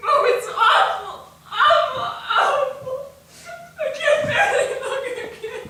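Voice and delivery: breaking down, loud, high, half laughing half sobbing; hysterical sobbing. Acted. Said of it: "What is it, darling?".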